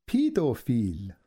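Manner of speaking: "Pedophile" said slowly, syllable by syllable, in a foreign voice; careful pronunciation. Retold or imitated. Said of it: "she carefully pronounced each phrase".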